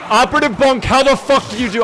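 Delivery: loud, high yell at Bunk; exasperated yell. Real, not acted. Yelling at Operative Bunk in disbelief, loud and high. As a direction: shout in disbelief at his teammate.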